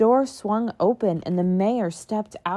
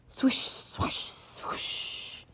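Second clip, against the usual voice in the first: soft whispered sweeping sound; whispered swoosh; 11 dB quieter, much slower than usual. Acted. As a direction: brush the words like a broom.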